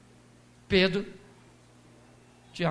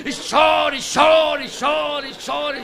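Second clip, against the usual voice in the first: urging shout; 3 semitones higher, 3 dB louder, much slower than usual. Acted. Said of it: "preacher urging mourners to cry".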